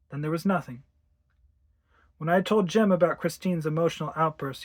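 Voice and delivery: ordinary delivery, nothing marked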